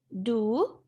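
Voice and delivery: ordinary talk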